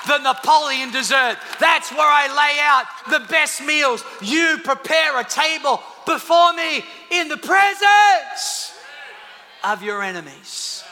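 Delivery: loud, rhythmic preacher's refrain; shouted sermon climax; preaching at full force. Acted. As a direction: hammer each line home to the crowd. Preacher builds repeated refrain.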